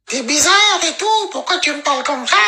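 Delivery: high mocking imitation of a sulky young woman; mocking the girl's voice. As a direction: squeal it like a pouty girl mocking her. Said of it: "Vous connaissez sa bouche".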